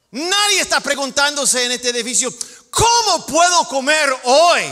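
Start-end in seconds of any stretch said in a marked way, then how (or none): 2.7-4.6 loud anguished plea, higher; hammed-up hungry man's shout; desperate cry. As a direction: cry out like someone starving. Voicing a hungry desperate person.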